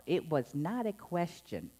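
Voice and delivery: ordinary delivery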